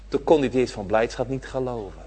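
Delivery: ordinary talk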